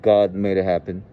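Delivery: ordinary delivery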